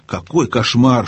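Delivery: usual speaking voice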